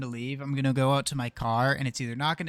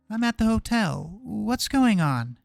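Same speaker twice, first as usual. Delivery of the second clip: worried woman; 8 semitones higher, 7 dB louder, slower than usual. Acted. Woman voiced, worried, slow.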